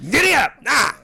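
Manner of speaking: loud, high cowboy holler; hammed-up rodeo yell; rider's whoop. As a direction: whoop it like spurring a horse.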